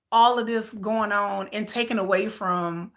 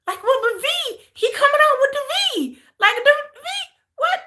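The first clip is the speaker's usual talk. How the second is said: mock disbelief. Mocking disbelief about V news.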